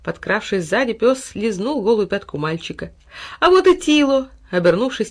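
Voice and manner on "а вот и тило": high, loud happy call; excited and raised; delighted greeting. A narrator's reading. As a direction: call out on spotting an old friend. Boy spots his dog.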